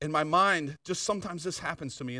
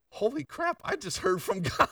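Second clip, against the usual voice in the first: overjoyed exclamation; 9 semitones higher than usual. Retold or imitated. Hearing from God, overjoyed.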